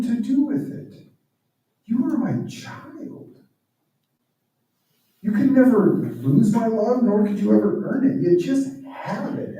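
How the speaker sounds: father to both sons, rising urgent, then gentle; impassioned father. Retold or imitated. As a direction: proclaim it like a father who won't hear of earning love. Father's passionate reply to both sons.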